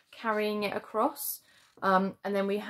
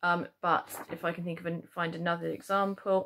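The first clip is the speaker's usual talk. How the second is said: ordinary talk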